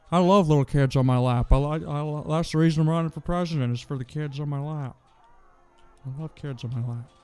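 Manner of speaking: mock old man keeping his teeth in; Joe Biden impression. Streamer mocks a politician for chat, teeth-in voice. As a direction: talk as if holding loose teeth in with your tongue. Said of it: "Now I'm talking like Joe Biden with my teeth almost falling out".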